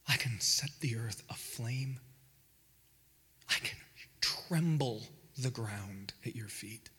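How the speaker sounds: a powerful father speaking softly to his son; tender whisper as God. Acted. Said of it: "God speaking".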